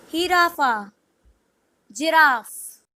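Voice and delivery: ordinary talk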